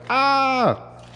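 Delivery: high, dramatic mock death cry; hammed-up high voice of a dog that has just died; dramatic dying dog. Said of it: "it's gonna be like".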